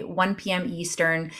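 ordinary delivery

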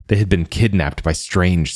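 ordinary delivery

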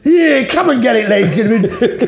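loud, high market trader's call; street hawker. Pretend. Hawker touting a friend's arse to passers-by, mock market cry. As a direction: holler to the crowd like a barker.

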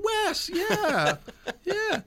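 very high Southern drawl; imitated high-pitched Southern accent. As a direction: mimic a Southern lady, voice way up high. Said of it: "her southern, like, drawl kind of accent".

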